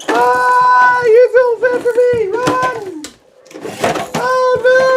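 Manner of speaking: high, playful pretend panic; mock panic. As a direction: squeal in mock fright from the bad guy. Playing chased by toy villain.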